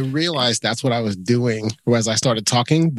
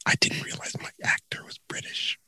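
whisper; 3 semitones lower, 7 dB quieter than usual. Real, not acted. Whispered aside after wrong accent.

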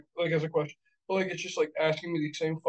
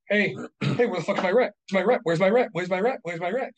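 loud demanding landlord yell; gruff impatient scolding; 3 semitones higher, 6 dB louder than usual. Pretend. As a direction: bark for the overdue rent. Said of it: "I do, like".

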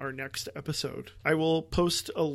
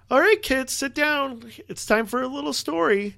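high, loud bedtime-story voice; playfully gather the kids for a story; storytime parent voice; 10 semitones higher, 8 dB louder than usual. Pretend. Mock parent reading bedtime story.

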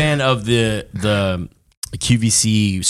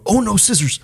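put-on kid in the background; 8 semitones higher than usual. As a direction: mimic a kid crying out from afar. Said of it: "you hear a kid in the background go".

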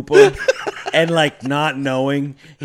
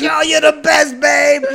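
shouted high praise, hammed up; mock cheer; 11 semitones higher, 4 dB louder than usual. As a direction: cheer her on like a proud husband. Husband cheering wife on.

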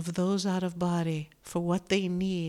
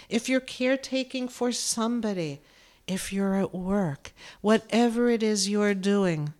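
ordinary talk

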